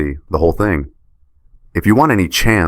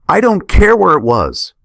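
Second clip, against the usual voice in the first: much higher and louder, furious shout; angry outburst; 14 semitones higher, 4 dB louder than usual. A narrator's reading. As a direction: explode at her, cutting her off. Husband erupts in the argument.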